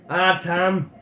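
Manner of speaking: a Highland burr, half lamenting; Highland accent. Acted. Doogie rueing their folly to Tam, Highland accent.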